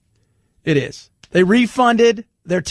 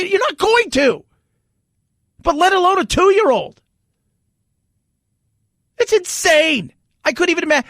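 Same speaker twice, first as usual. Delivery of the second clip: high-pitched, worked-up ranting; exasperated rant; 8 semitones higher than usual. Real, not acted. Host venting to listeners about the mask ban, heated rant. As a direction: vent your outrage at the airline.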